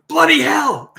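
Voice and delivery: loud, high cry of alarm; a woman shrieking in the dark; startled shout. Acted. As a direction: yell it out in sudden fright.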